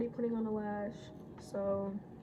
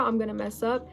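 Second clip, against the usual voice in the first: normal talking voice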